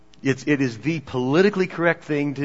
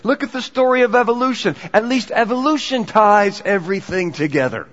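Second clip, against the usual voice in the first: insistent rebuttal, loud and emphatic; Steven Weinberg; 4 semitones higher, 5 dB louder than usual. Retold or imitated. Scientist rebutting the postmodern philosophers at a conference, insistent. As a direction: push the point hard, climbing higher to the end. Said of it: "by Steven Weinberg, who said".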